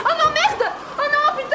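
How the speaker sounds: high, loud wail; hammed-up tearful panic; distraught cursing. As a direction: curse as if disaster just struck. Acted disaster as period arrives.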